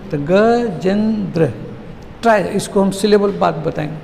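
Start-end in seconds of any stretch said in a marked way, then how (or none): 0.2-1.5 "Gajendra" slowly, each syllable stretched and separate; split into syllables. Shown as a demonstration. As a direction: pull "Gajendra" apart syllable by syllable. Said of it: "उसके possible टुकड़े कितने हो सकते हैं".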